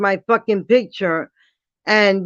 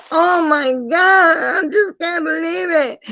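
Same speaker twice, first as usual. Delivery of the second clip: fake crying; 8 semitones higher than usual. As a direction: wail it as a put-on cry for views. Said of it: "somebody else will cut up here and say".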